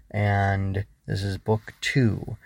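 ordinary delivery, nothing marked

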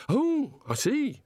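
high, airy god voice; genial, naive god. Acted. God answering the embarrassed wizards, brightly interested. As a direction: innocent enlightenment.

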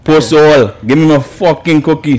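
put-on Jamaican accent. Acting the customer demanding a cookie, Jamaican accent. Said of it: "That sound Jamaican".

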